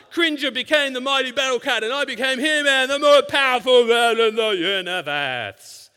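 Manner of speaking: hammed-up heroic shout; He-Man voice. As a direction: keep the cartoon hero at full blast. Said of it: "I'm quoting him".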